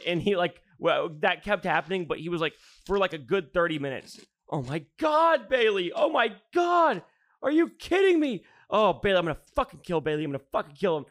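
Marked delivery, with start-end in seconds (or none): 4.5-11.1 mock outburst, high at first, then dropping; exasperated rant. Acting out his long exasperated rant.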